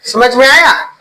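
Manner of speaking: normal talking voice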